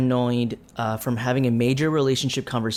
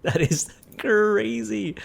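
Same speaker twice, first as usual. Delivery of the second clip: amused disbelief; 8 semitones higher, much slower than usual. Real, not acted. Reacting to absurd game dialogue.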